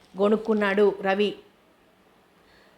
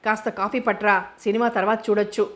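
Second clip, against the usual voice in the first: ordinary delivery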